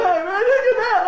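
high, loud laughing call; laughing brother. Retold or imitated. Brother watching him drown.

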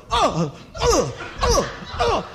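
high, laughing staccato grunts; pulsing jolts. Retold or imitated. Imitating his own grunts as the fence pulses through him, high jolted grunts. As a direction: grunt in time with each electric pulse. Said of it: "I'm going".

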